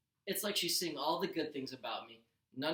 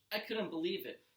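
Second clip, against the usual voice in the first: ordinary delivery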